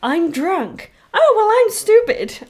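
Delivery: high, loud mock whine; mock dopey heroes. Mocking the Avengers.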